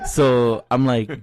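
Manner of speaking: ordinary talk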